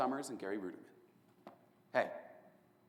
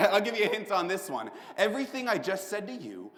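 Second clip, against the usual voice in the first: laughing taunt; 7 semitones higher, 6 dB louder than usual. Acted. Laughing confession of lies.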